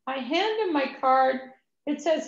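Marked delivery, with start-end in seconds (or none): none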